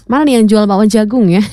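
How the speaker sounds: normal talking voice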